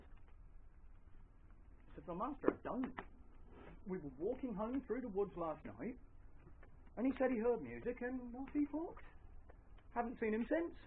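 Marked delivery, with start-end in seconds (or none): 2.1-10.7 lightly voiced farmhand, higher-pitched; earnestly account for yourself to the master; a hint of anxious innocence, voice lifted; Llewellyn, earnest farmhand.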